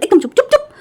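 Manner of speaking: furious shout; angry yell. Acted. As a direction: scream at him to shut up. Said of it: "न्यूसन चिल्ला के बोला".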